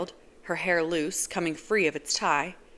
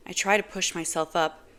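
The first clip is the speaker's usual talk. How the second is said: ordinary delivery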